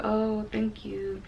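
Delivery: a barely-there, polite brush-off; awkward thanks. Retold or imitated. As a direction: accept it politely while not meaning it. Awkwardly accepting a compliment.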